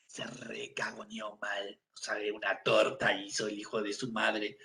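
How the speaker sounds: hushed unvoiced aside; whisper. Real, not acted. Dad whispering to partner about the diaper mess. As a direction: whisper it so nobody hears.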